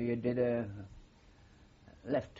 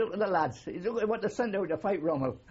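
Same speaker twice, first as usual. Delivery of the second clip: laughing mock scorn; 6 semitones higher than usual. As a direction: relive the sergeant's sneer while cracking up. Sergeant mocks recruits, teller laughing.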